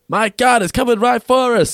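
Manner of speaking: high, loud mock-alarmed hunter shout; cartoon hunter quote. Podcaster quoting the hunters' famous catchphrase, high mock alarm. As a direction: cry it out like a cartoon hunter faking a threat. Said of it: "And we get the first".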